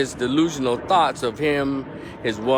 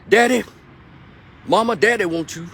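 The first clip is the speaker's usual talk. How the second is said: put-on higher and louder, a rough little girl calling; hard-voiced little girl; 10 semitones higher, 6 dB louder than usual. Little girl calling her mother across the street, high and loud. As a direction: yell it across the street like a tough kid. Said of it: "Hard voice ass little girl".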